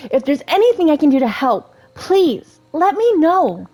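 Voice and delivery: high-pitched princess voice; high, sweetly earnest character read; Rapunzel, earnest and helpful. Acted. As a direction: offer help as an eager game heroine. Resumes Rapunzel's dialogue voice.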